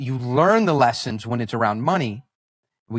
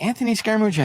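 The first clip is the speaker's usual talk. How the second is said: blank echo; 10 semitones higher, much faster than usual. Retold or imitated. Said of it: "I'm like".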